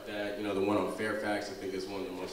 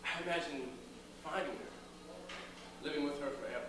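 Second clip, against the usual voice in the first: higher, impassioned stage delivery with long pauses; dramatic monologue; 6 semitones higher than usual. Acted. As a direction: recount a lost love to an audience, pausing for weight.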